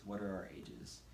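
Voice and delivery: normal talking voice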